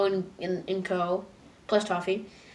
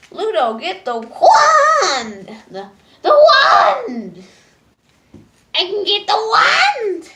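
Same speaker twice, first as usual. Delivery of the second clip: slow, much higher, louder frantic yells; villains shouting; 12 semitones higher, 11 dB louder, much slower than usual. Retold or imitated. Monsters yelling at Ludo for the wand, frantic shouts. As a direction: scream for the wand. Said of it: "everyone's like".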